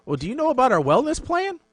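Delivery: high, chirpy sales pitch; bright telemarketer lilt; sugary sales caller. Retold or imitated. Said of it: "She's like".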